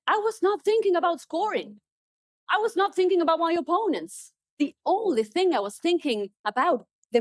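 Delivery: athlete recounting. Retold or imitated. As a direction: tell it in wonder, pitch up. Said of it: "And he said".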